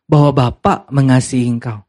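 normal talking voice

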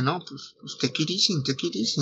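soft, hushed calming words; quiet. Retold or imitated. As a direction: ease him down gently. Abra calming a kidnapper. (tranquilizando en voz baja)

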